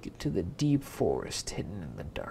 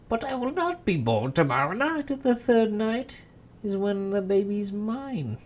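gloating imp; 7 semitones higher, 5 dB louder than usual. Acted. Imp gloats over the baby.

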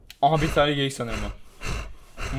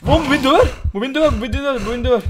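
panicked yelling; 9 semitones higher, 7 dB louder, much faster than usual. Real, not acted. Panicked gamer fleeing big animal.

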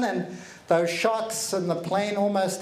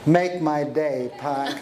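much lower, slow, menacing growl; tough-guy movie line; 6 semitones lower, slower than usual. Retold or imitated. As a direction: deliver it like a gunslinger cop. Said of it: "and go".

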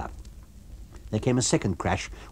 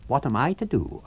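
higher, mocking echo of the question; invisible man's echo; 7 semitones higher than usual. The invisible man mocks Eddie's question, echoing. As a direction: throw his words back at him. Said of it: "asked the invisible man".

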